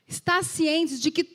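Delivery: slow, loud, ringing scripture read; solemn preacher reading. Acted. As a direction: proclaim the verse to the church.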